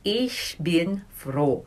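Ich bin froh said very slowly and softly; slow German demo. Shown as a demonstration. Teacher giving the German for happy, slow and soft. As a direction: model Ich bin froh gently, word by word. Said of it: "I am happy".

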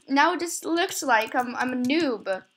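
ordinary talk

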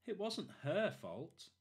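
put-on high, slow child's voice; little girl voice. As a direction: plead gently like a little girl. Debbie defends the cat.

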